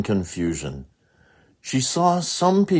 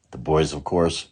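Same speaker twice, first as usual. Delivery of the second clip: plain reading voice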